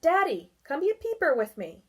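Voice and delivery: put-on high, loud, fast child call; boy shouting to his father; shouted. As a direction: call Daddy over across the grass. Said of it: "Then he shouted".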